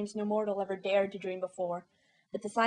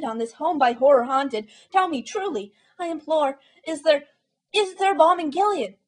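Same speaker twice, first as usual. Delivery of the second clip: high, loud frantic plea; desperate pleading; 5 semitones higher, 7 dB louder than usual. A narrator's reading. Narrator frantically begging the raven for an answer. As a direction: beg the bird with rising desperation. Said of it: "said I".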